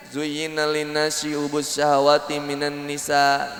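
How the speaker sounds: slow, low, measured recital; Quran recitation. Acted. Preacher quoting scripture to the congregation, slow recitation. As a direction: draw the Arabic out evenly.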